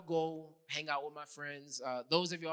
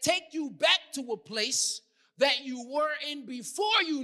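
raised, emphatic sermon voice; preaching; 9 semitones higher, 7 dB louder than usual. Real, not acted. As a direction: preach with deliberate stresses and pauses. Preaches at full force.

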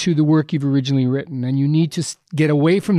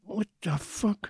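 angry; 6 semitones higher, 12 dB quieter, slower than usual. Retold or imitated. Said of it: "I was so angry".

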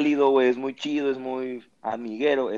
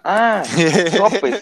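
retold correction; 4 semitones higher, 7 dB louder than usual.

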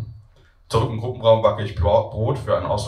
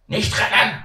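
teacher shouting; 12 semitones higher, slower than usual. Acted. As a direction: stop the kids running. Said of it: "schreit die Erzieherin".

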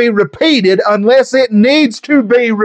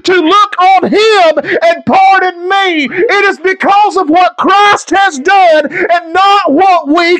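full-force preaching; 5 semitones higher than usual. Acted. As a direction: preach it, hammering each line home. Preaching climax, rising to full force.

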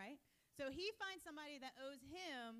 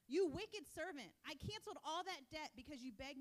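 stern rebuke; 6 dB louder than usual. Acted. Master rebuking the wicked servant, loud and stern. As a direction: bring down judgment on the wicked servant.

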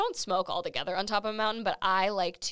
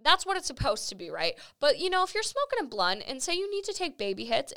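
ordinary delivery

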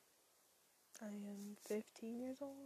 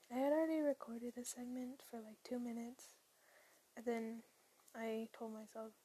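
usual speaking voice

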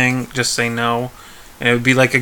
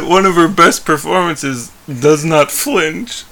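ordinary delivery

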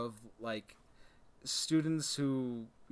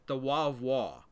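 imitated Northeastern professor, WA for both law and war; Northeastern accent; 5 dB louder than usual. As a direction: drop the R, Northeastern-style WA. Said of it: "it just sounded like they said".

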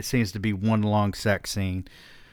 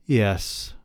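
usual speaking voice

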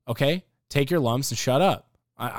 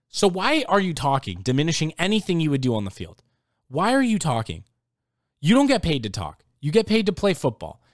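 loud, higher scolding questions; 5 semitones higher, 4 dB louder than usual. Real, not acted. Telling Claypool he's paid to play, not talk, scolding loudly. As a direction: scold him for running his mouth.

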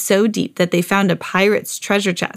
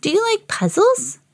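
high sweet doll voice; 13 semitones higher than usual. A narrator's reading. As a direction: voice Bitty Baby high and small. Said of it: "asked Bitty Baby".

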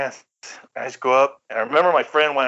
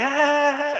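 very high, drawn-out "ah"; mock giggly squeal; 14 semitones higher than usual. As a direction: imitate giggling pranksters with a high cry. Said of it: "they're giggling and shit".

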